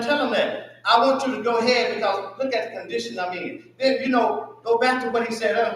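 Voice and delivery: normal talking voice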